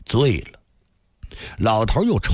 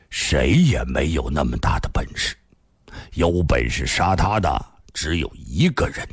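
put-on lower, measured old man's voice; old grandfather; 7 semitones lower than usual. A narrator's reading. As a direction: answer gravely as the old storyteller. Old man answers the startled girl. (老爷爷低沉地回答)

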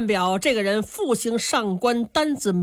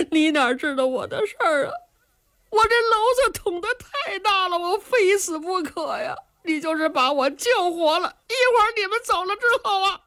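higher, crying complaint that rushes at the end; sobbing despair; 10 semitones higher than usual. Acted. Young man sobs to Qin Qiong that he must die. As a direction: weep out your hopeless story.

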